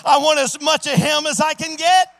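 pleading, climbing preacher's cry; full-force preaching. Acted. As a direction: preach it like a revival altar call. Testimony climax, loud preaching.

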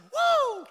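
high, loud ecstatic "woo!"; whooping. Retold or imitated. Imitating a woman lost in the Spirit, high whoop. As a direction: let out a piercing church whoop. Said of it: "And she started".

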